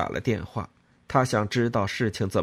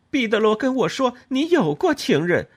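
高声尖锐地揭穿; 当面把丑事抖出来; 愤怒揭短; 12 semitones higher, 6 dB louder than usual. A narrator's reading. 揭婆婆的短. (高声愤怒地揭穿婆婆)

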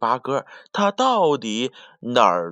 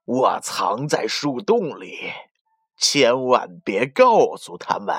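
sly wolf. Acted. Bad wolf asks the myna to keep his secret, sly and slow. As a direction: coax it like a sneaky wolf.

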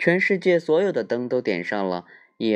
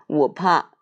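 little bear voice; 6 semitones higher, 5 dB louder than usual. Acted. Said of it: "小小熊说".

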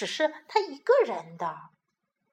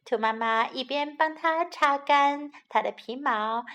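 plain reading voice

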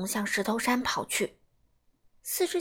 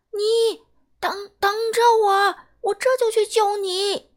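calling out; 8 semitones higher, 9 dB louder than usual. Acted. Dad calls down to trapped baby.

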